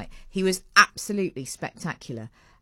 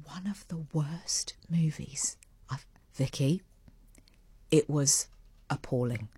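whisper; 3 dB quieter than usual. Real, not acted. Confiding a bad movie verdict.

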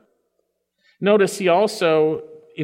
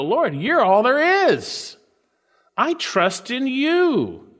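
heartfelt prayer; 6 semitones higher, slower than usual. Pretend. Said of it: "We go".

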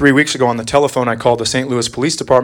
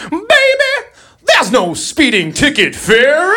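much higher, slower sassy lady voice; 10 semitones higher, much slower than usual. Retold or imitated. Officer mocks caller with a higher, slow sassy voice. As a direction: play the phone clerk calling him baby. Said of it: "And she said".

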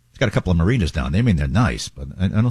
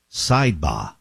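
put-on Boston accent; 4 semitones lower, slower than usual. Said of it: "in his Boston accent".

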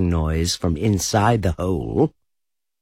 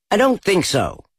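rabbit voice; 10 semitones higher, 3 dB louder than usual. A narrator's reading. Rabbit pretending not to be Rabbit.

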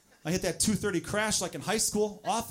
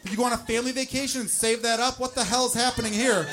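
loud, high, incredulous ranting; outraged rant; 7 semitones higher, 6 dB louder than usual. Retold or imitated. Man ranting at his own load, loud mock outrage.

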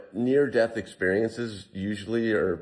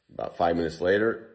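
normal talking voice

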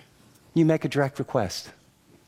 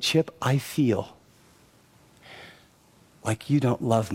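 calm, teary plea; 7 semitones lower than usual. Retold or imitated. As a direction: gently tell him he's hurt you, near tears. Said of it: "she looks at me very calmly, and she, her eyes are watery".

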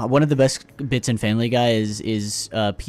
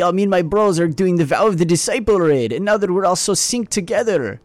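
imitating a German accent, higher pitched; 8 semitones higher than usual. A fish with a German accent boasting about a raid. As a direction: play Klaus as a German gamer bro. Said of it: "he's like a German accent".

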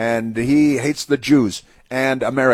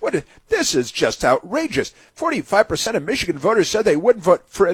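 high, put-on indignation; pitched-up, hammed-up dismay; mock astonished outrage; 5 semitones higher than usual. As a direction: imitate someone scandalized by a poll. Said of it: "she's astonished".

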